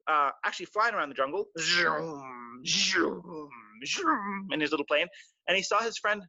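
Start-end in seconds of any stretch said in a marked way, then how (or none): 1.6-4.5 zoom. Acted. Plane sound effect.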